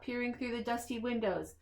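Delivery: ordinary delivery, nothing marked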